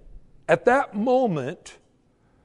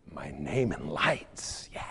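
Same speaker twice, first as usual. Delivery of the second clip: whispered, low and slow; mock dreamy whisper; 10 dB quieter, slower than usual. Preacher mocking a worldly wish, dreamy whisper. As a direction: breathe it like a secret fantasy.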